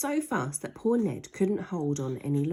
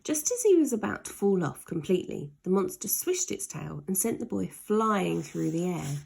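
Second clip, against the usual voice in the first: usual speaking voice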